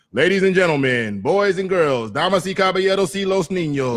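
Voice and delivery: booming, high ring announcer call; ring announcer intro. Shown as a demonstration. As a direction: belt it out to the arena crowd. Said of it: "that's actually, like, my opening to all my events".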